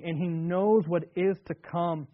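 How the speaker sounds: normal talking voice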